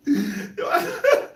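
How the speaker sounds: usual speaking voice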